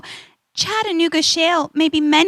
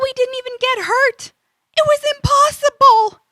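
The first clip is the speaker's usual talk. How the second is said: amazed; 8 semitones higher than usual. Acted. Excited disbelief after surviving jump.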